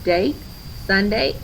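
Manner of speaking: ordinary delivery, nothing marked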